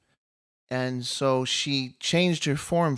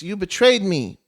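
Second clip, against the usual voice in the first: loud, raised-pitch acted anger; angry accusation; 6 semitones higher, 7 dB louder than usual. Angry demon confronts his sister, loud acted accusation. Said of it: "he's angry".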